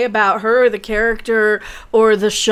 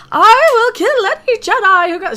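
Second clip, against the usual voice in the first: mock villain threat; 9 semitones higher, 5 dB louder than usual. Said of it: "She's like".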